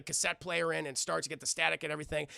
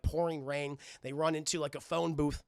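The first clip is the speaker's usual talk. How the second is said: ordinary delivery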